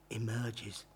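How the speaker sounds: ordinary delivery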